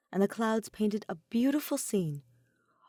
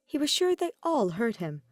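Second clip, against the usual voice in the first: plain reading voice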